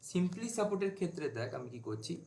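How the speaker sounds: ordinary talk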